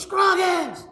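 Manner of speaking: high, shouted sergeant's command; shouting drill sergeant. Retold or imitated. Drill sergeant yelling up high at the frozen recruit. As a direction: bark it down from the clifftop. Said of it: "he goes".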